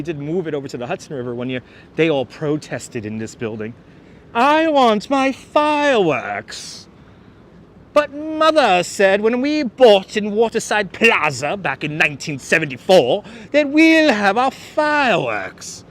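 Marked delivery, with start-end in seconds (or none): 4.3-6.9 high, slow whiny resident; drawn-out mock whine; mock protester. 7.9-15.8 higher, slow, louder whiny voice; hammed-up rich-kid whine; mock entitled resident.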